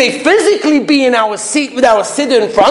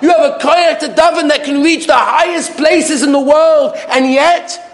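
preaching fire; 7 semitones higher than usual. Real, not acted. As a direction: lift the crowd, pitched high. Preacher rises to full force.

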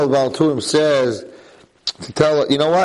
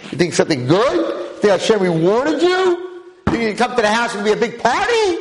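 mocking accusation; 9 semitones higher than usual. Satan mocking Avram after the Akedah.

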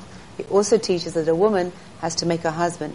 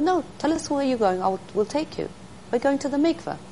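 lightly voiced kind women, higher; high voiced ladies; 8 semitones higher than usual. Ladies urging her, voiced high.

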